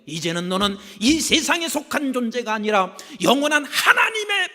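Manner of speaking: loud, rising preacher's cry; full-force sermon climax; fiery preaching. Acted. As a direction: proclaim it to the congregation.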